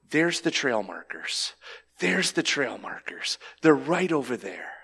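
soft, near-whispered guidance; gentle, hushed pointing. Acted. God pointing a strayed walker to the markers, gently hushed. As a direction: softly show the way back, hushed.

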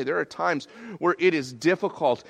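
ordinary delivery

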